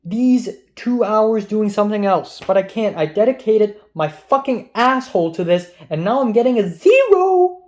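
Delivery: venting real rage at a failing grade, yelling; furious shout. Real, not acted. A student yelling furiously into the camera about his grade. Frustrated outburst over getting zero.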